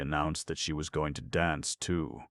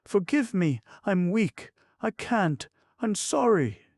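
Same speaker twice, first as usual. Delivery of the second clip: much higher, louder voice apologizing; high apology; 7 dB louder than usual. A narrator's reading. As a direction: beg forgiveness, tipsy and weak. Drunk Grushenka apologizes.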